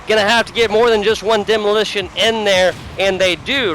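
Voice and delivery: high, loud esports call; excited play-by-play. Real, not acted. Caster calling a demolition attack to viewers, excited play-by-play.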